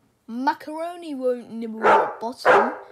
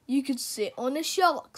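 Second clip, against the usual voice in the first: plain reading voice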